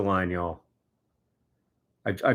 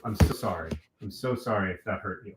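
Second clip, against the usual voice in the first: soft apology; 5 semitones lower, 6 dB quieter than usual. Real, not acted. Apologizing to the pet.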